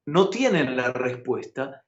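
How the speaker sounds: ordinary talk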